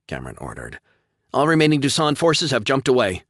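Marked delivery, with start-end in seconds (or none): 1.3-3.2 high loud triumphant report. A narrator's reading. Officer announcing victory to captain, triumphant. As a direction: announce it proudly. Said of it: "Kono announced triumphantly".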